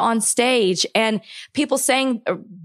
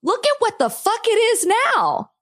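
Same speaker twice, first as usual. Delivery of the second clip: high, exasperated cry; exasperated outburst; 11 semitones higher than usual. Real, not acted. Venting to her cohost about the country, high exasperated. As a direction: throw it up high in frustration. Heated rant about politics.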